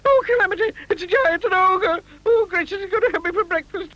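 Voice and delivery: high, fast frightened wailing; terrified little man sure he'll be eaten; panicked squeal. A narrator's reading. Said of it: "he screamed".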